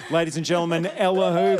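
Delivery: loud, high show announcer. Acted. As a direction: introduce her like a compere. Announcing the guest.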